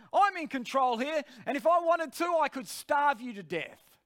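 high, loud declaration of control; commanding authority. Retold or imitated. Preacher's spirit threatens his flesh, with forceful authority. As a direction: lay down the law loudly. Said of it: "saying things with such authority".

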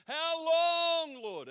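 high shout, long held vowel; yelled plea. Retold or imitated. Preacher crying out to the Lord for an answer, yelling. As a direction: yell it out, stretching the middle word. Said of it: "a yell like that".

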